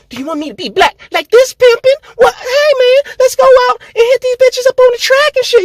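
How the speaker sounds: very high, fast hammed-up pimp caricature; mock pimp voice. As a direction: ham up a street hustler.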